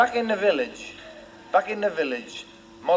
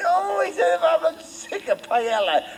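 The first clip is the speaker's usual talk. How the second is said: much higher, slow put-on voice; mock fed-up hero; 12 semitones higher than usual. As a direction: whine it in a silly character voice. Hero fed up with Spanish food.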